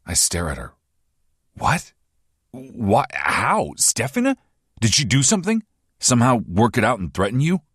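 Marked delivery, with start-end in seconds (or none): 1.5-7.6 higher and louder, questions tumbling out fast; alarmed, demanding answers; shocked disbelief. Acted. Hero stunned to hear his fiancée's name, pitch shooting up.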